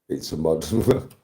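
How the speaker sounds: helpless laugh on the last word; breaking into laughter. Real, not acted. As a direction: laugh breaking in mid-sentence. Repeats line and breaks into laughter.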